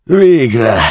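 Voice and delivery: high, loud burst of relief after a long night; relieved exclamation. Acted. Prisoner to the customs officer at the door, relieved loud cry. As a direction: finally, let it burst out.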